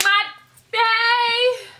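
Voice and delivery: high, drawn-out imitated greeting; mock enthusiastic greeting. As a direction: call out like an overfriendly acquaintance, hammed-up. Imitates people greeting her with slur.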